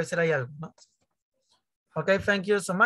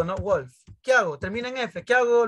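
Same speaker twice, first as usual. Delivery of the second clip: ordinary delivery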